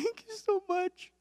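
high, squeaky imitation of tearful blubbering; hammed-up crying, pitched far above your own voice; mock crying woman. Preacher imitating a crying lady at the gas pump, mock sobbing. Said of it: "she starts crying".

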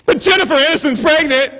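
high, loud gushing announcement; mock excited. Wife cheers husband with gossip, mock excited. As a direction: chirp it as thrilling news, hammed-up.

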